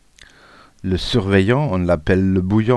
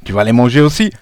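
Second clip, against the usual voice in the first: louder, insistent schoolboy threat; threatening retort; 3 semitones higher, 6 dB louder, faster than usual. A narrator's reading. Pupil threatening to make classmate eat his glasses, defiant. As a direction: retort loudly, not backing down.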